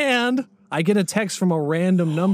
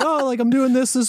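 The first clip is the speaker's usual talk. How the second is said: high-pitched imitation of his own texting; higher voice for a retold text; mock casual invite; 9 semitones higher than usual. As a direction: play up an eager, casual invite. Retells own texts inviting teacher.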